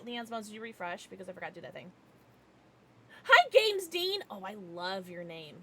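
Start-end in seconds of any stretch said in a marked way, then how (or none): 3.2-4.2 excited greeting. Real, not acted. Greeting chatter.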